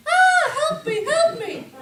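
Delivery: high, loud mock cries for help; screaming fox. Cornered fox crying out at the cat, comically screaming. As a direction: shriek like a woman being attacked. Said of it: "sounds incredibly like a woman being attacked".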